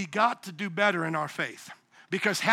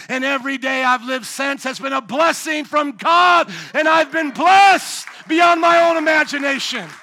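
rising into a full-force shout, higher; preaching shout; 5 semitones higher, 9 dB louder than usual. Retold or imitated. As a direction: proclaim it to your kids at full voice. Father's dying wish told to children.

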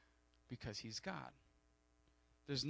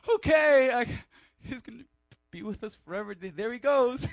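mock crying; 10 semitones higher, 15 dB louder than usual. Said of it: "waving by and crying like".

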